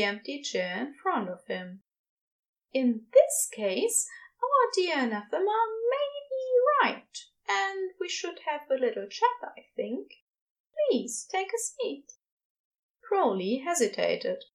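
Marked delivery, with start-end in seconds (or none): 2.7-12.1 higher, proper character voice; high, politely inviting gentleman; Ezra, polite and gentle. A narrator's reading. Ezra invites Crowley to sit and chat, politely.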